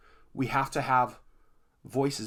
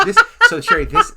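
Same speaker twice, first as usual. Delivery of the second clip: much higher, louder, through laughter; laughing; 14 dB louder than usual. Real, not acted. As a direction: laugh over his own mistake. Laughing at his slip.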